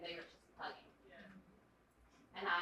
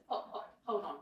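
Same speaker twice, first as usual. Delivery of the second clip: normal talking voice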